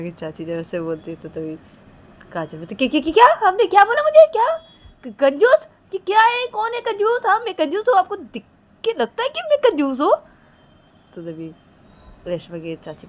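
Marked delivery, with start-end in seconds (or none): 2.7-10.1 high, loud indignant questioning; outraged. Acted. Kajal reacting to being called a miser, outraged. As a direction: fire off offended questions at a high pitch. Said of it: "गुस्सा मत करो ना".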